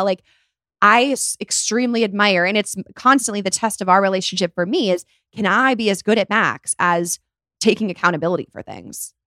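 normal talking voice

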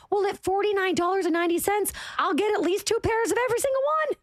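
excited. Real, not acted. Host raving to listeners about cheap slippers, excited and fast. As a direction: gush about the deal, talking fast.

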